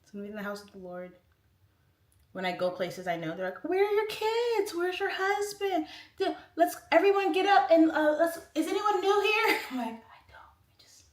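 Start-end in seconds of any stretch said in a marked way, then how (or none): 3.6-9.6 high, loud, over-eager church greeters; mock nosy churchgoers. Churchgoers quizzing a woman who came alone, mock loud and high. 9.9-11.0 barely voiced, shrinking reply; mock timid whisper. Shy churchgoer answering the greeters, whispered.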